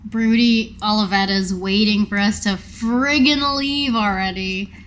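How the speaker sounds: ordinary talk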